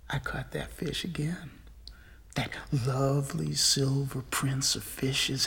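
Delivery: soft, low, confiding narration; hushed telling. A narrator's reading. Fisher revealing he caught that fish again, hushed.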